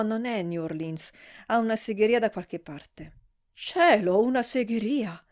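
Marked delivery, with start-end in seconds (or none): 3.5-5.2 a sudden louder gasp of dismay; surprised exclamation. Acted. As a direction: blurt it out, appalled. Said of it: "esclamò Belle".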